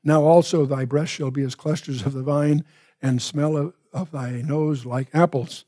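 usual speaking voice

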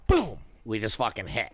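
ordinary talk

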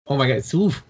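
usual speaking voice

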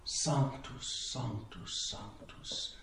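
soft words fading to a whisper; hushed adoration. Retold or imitated. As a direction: murmur it in awe, dying into a whisper. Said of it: "all he could say during adoration was".

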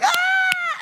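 sudden high delighted shout; excited cheer. Retold or imitated. Parent cheering a baby's first step, loud high shout. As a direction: yelp with joy at the first step.

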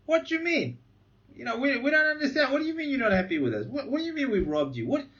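high, incredulous mock protest; self-righteous indignation. Preacher voicing self-righteous people questioning God, indignant. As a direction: act baffled and offended. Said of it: "instead of an attitude of humility".

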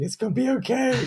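hammed-up tearful reassurance; mock weepy comfort. Weeping at the screen, mock tearful comfort. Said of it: "weeping with my hand on the screen saying".

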